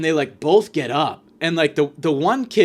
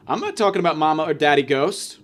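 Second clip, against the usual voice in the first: ordinary talk, nothing marked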